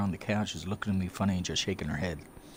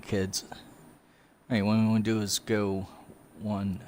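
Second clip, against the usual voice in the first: ordinary talk, nothing marked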